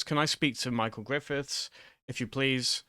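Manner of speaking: ordinary talk, nothing marked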